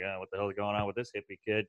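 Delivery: low, quick, hushed aside; soft mock disapproval; muttering mom. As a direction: imitate a mom grumbling under her breath.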